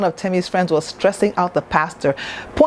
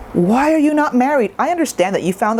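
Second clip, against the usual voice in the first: mock nagging auntie, high; a hint of a prying relative; 5 semitones higher than usual. Mocking Nigerian aunties' nagging.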